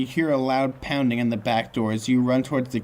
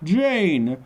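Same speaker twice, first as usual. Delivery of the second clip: drawn-out, slightly raised calling; mock bellow; 4 semitones higher than usual. A narrator's reading. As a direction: call out for someone without real volume. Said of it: "calling for Jane in the bellowing voice".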